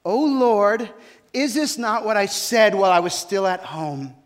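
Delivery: exasperated Jonah calling out, accusing; angry prophet. Acted. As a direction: Jonah protesting his anger at the Lord. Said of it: "calls out to him in his anger and says".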